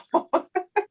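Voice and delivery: high giggle; light, high-pitched chuckle; a real giggle after being caught out; amused laugh. Real, not acted.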